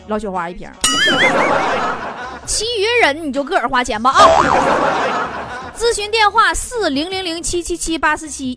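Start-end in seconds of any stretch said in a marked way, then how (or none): 0.7-2.4 hearty laugh. Real, not acted. Host laughs at own joke. 4.2-5.7 hearty laugh. Real, not acted. Host laughs at own joke.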